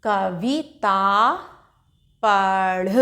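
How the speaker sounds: Kavita drawn out slowly with a rise at the end; slow teacher blending; slowly blended word. Shown as a demonstration. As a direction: sound out Kavita for a beginner. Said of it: "उसको आ का उच्चारण संग में करेंगे".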